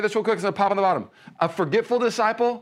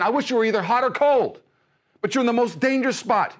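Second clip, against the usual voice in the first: preacher voicing God's urgent wish; God's plea, voiced; 8 semitones higher than usual. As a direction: cry it out like God pleading. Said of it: "So God's saying".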